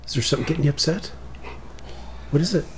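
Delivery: pet-talk voice. Acted. Talking to upset dog.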